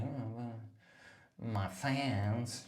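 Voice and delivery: a lightly affected, mocking take on Jordan; put-on Jordan voice. Comic mocking a fellow comedian's way of talking. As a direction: imitate her as a bit of a dickhead. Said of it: "She doesn't speak like that".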